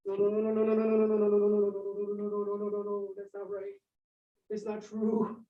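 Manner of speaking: rising then fading frantic no's; anguished denial; desperate denial. Acted.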